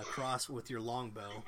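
normal talking voice

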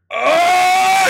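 high, loud retold scream of rage; dad screaming in anger in the breakdown lane; furious scream. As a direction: let out an angry howl. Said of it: "he went".

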